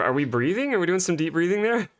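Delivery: ordinary talk, nothing marked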